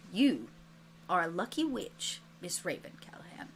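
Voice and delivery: low, soft, measured pronouncement; subdued teacher addressing a student; hushed teacher. Acted. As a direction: say it quietly, with weighty pauses. Teacher character addresses injured student.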